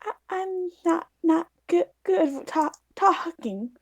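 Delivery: timid high voice caught off guard; nervous stammer. Acted. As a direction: stumble over the words, scared of being blamed. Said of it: "and she's like".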